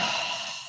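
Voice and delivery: a soft breathy sigh as the shoulders drop; releasing sigh. Shown as a demonstration. Yoga teacher showing the class a shoulder-release sigh. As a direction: let the tension out on a sigh. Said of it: "letting it out with a sigh".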